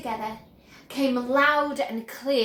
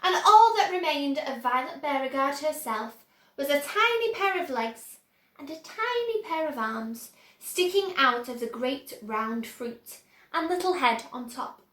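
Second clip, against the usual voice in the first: plain reading voice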